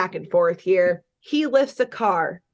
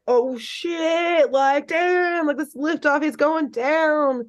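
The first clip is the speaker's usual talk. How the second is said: high, excited crowd reaction; awestruck crowd; 6 semitones higher, slower than usual. Retold or imitated. Crowd watching the deadlift go down, high-pitched mock amazement. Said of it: "And everyone's like".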